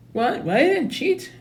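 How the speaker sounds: put-on a kid's high-pitched "what?"; shocked denial. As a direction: protest your innocence in a high squeak. Kid denying cheating.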